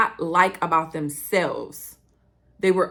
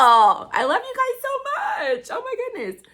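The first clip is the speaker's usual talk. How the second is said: gushing affection. Real, not acted. Podcaster to her listeners, gushing excitedly. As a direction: squeal it with excited love.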